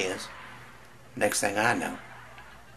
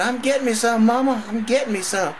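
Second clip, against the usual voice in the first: much higher, louder gleeful hollering; high excited yell; 7 dB louder than usual. Acted. Son hollering back at Mama, high and gleeful. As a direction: yell it out mid-thrust, thrilled.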